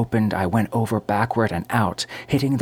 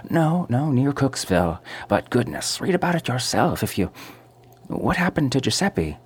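put-on lightly higher, chattering old landlady; gossiping landlady; 6 semitones higher than usual. A narrator's reading. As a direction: rattle on, half thrilled. Landlady changes subject eagerly.

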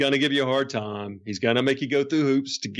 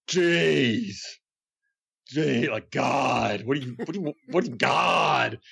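annoyed Brian; 3 semitones higher, slower than usual. Retold or imitated. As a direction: sound drained at the phone call. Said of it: "Every time I call, it's like".

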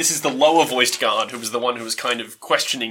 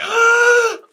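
sharp gasp; 8 dB louder than usual. Real, not acted. GM reacts in surprise.

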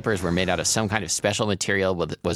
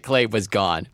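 usual speaking voice

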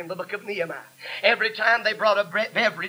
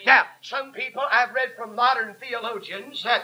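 full-force sermon with gasped breaks between phrases; fervent preaching; 3 semitones higher, 4 dB louder than usual. Real, not acted. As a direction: drive each phrase home to the congregation.

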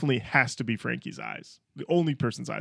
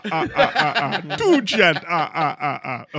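loud, high counting with staccato laughs; 3 semitones higher, 8 dB louder, slower than usual. Acted. Host counting djents as a cartoon count, booming mock laugh. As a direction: boom each number, then laugh in bursts. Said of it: "The count is in".

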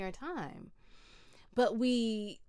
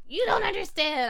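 retold furious yell, much higher and louder; mad retort; 13 semitones higher, 11 dB louder than usual. Retelling yelling at her father on the phone, loud and angry. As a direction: snap it back like a hung-up phone call. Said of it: "I was so mad".

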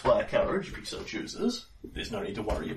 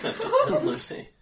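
normal talking voice

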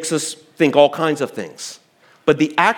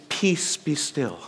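much softer, slow reverent phrasing; pious rendering; 9 dB quieter, slower than usual. Retold or imitated. Older scripture version read to the congregation, soft pious hush. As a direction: say it like an old hymn. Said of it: "it's more pious".

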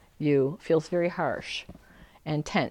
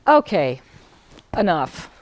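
fed up; 7 dB louder, slower than usual. Shown as a demonstration. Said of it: "one day you thought".

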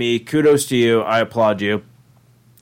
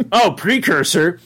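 ordinary talk, nothing marked

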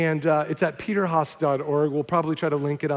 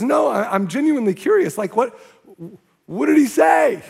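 much higher, louder, urgent; pleading shout; 6 semitones higher, 5 dB louder than usual. Retold or imitated. The man insists to the evangelists, shouting. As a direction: demand the answer. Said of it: "And he goes".

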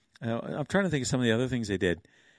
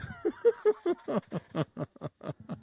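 high laughing shout; 13 semitones higher, 4 dB quieter than usual. Real, not acted. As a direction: cackle at the punchline. Laughing at own joke.

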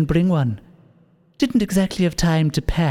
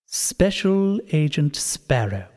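very slow, savoring each word; slow menacing; much slower than usual. Acted. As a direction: drawl it out like a villain. Villain greets agent slowly.

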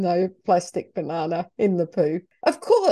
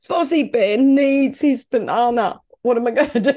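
high, wailing mock sob; mock crying; 5 semitones higher, 5 dB louder than usual. Owner pretending to cry over the lost Lego banana, mock sobbing. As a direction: whimper like a child losing a toy. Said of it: "I'm starting to cry".

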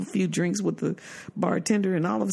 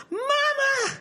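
calling out. Retold or imitated. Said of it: "I said".

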